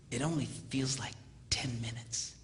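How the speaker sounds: soft unvoiced whisper; whispered aside. Dad whispering the joke to his son on the phone. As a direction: whisper it like a secret.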